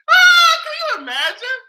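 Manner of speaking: high, loud gleeful shriek. Real, not acted. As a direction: squeal in disbelief. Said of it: "overcome with laughter".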